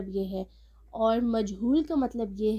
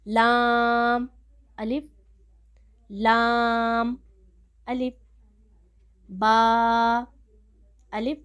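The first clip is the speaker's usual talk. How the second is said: each of "لام" and "با" drawn out very slowly and louder, with a pause before "الف"; spaced letters; 6 dB louder, much slower than usual. Shown as a demonstration. Teacher modelling spaced reading for students, slow with pauses. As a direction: say "لام ... الف" with a clear gap and keep the breath going. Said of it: "تھوڑا سا space دے کے پڑھنا ہے".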